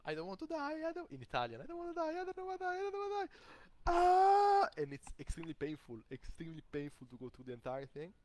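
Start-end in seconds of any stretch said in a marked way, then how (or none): 0.1-4.7 high, desperate cry of I don't want to die, rising to a scream; desperate pleading voice. Retold or imitated. Retelling his ayahuasca trip, voicing the panicked plea that came out of him, frantic high cry. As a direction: beg for life in sheer terror, climbing higher. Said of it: "then a voice comes out of me".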